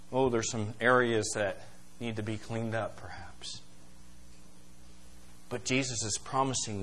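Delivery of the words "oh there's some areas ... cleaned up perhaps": softer, trailing off to a whispered perhaps; sheepish murmur fading to a whisper; sheepish aside. Retold or imitated. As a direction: admit it quietly, a little embarrassed. Confessing a messy life.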